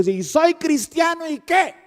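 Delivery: high, taunting challenge; mock angry driver. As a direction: taunt him like a hothead driver. (conductor burlón y desafiante, agudo)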